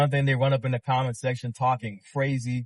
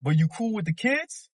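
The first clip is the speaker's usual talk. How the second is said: mock disbelief, pitched much higher; a retold baffled callout, higher up; incredulous question; 11 semitones higher than usual. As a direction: ask it like you can't believe him. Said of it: "I'm like".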